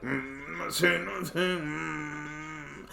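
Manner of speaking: soft, high closed-mouth hum; stiff, mute mumbling. Retold or imitated. Silent friend frozen before the cops, muffled mumble. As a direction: imitate someone too stiff to speak, barely audible. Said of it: "he just standing there stiff".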